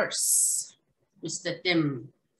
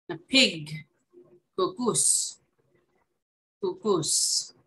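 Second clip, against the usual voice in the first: ordinary talk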